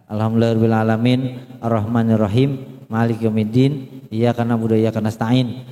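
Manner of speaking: hurried recitation, no sway on line endings; plain low reading with endings cut short; wrongly short endings. Shown as a demonstration. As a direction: rattle the verses off, clipping final long vowels. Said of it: "Belakangnya salah".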